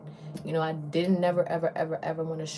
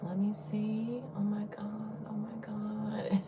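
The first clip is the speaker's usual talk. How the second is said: slow, hushed gasping awe; lady studying her face, in awed whisper; imitating a whisper; 4 semitones higher, 3 dB quieter, slower than usual. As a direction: breathe it out like a stranger admiring makeup. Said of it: "was like".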